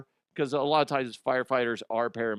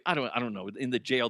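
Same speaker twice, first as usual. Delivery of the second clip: usual speaking voice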